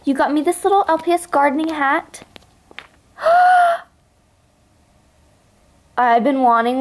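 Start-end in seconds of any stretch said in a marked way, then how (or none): 3.2-3.8 high loud gasp of excitement; thrilled loud gasp; loud delighted gasp. Real, not acted. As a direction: gasp like a wish came true. Seeing the gardening hat.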